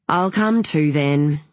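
ordinary delivery, nothing marked

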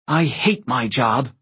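slow, grumbling frustration; growled. A narrator's reading. Praetor fed up with the chaos, slow growled complaint. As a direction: grind it out, fed up. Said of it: "Reyna growled".

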